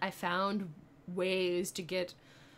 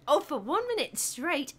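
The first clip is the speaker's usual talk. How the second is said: high, louder incredulous question; raised, questioning exclamation; surprised; 5 semitones higher, 6 dB louder than usual. Real, not acted. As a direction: take up a challenge in surprise. Reacting to accent challenge.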